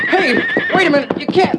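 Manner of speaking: high, loud yell at a bolting horse; alarmed shout. Acted. Boy yells at his panicking horse, high and loud. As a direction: shout to stop the runaway horse.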